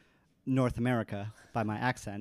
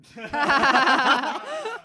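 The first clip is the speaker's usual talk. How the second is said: loud, high-pitched laugh; big high laugh; burst of laughter; 12 semitones higher, 11 dB louder than usual. Real, not acted. Joking about how she is described.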